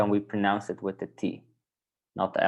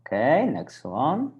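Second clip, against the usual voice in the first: usual speaking voice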